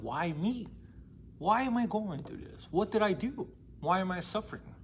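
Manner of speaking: lightly voiced little girl begging to know why; anguished pleading girl. Abused little girl asking God why, mild anguish.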